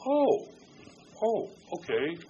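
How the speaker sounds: high "oh" easing down into acceptance; mock sudden realization. Listener turning an ear towards God, mild surprised realization. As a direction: act out a small dawning surprise.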